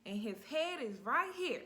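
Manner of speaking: high, loud urgent call; mother telling the nurse the baby is here, urgent and loud; urgent alarm. Retold or imitated. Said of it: "I said".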